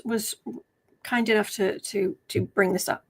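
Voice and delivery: ordinary delivery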